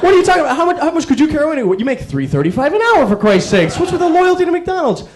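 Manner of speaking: mock-outraged customer yelling at the drive-thru worker; indignant rant. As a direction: argue back, pitch climbing in outrage.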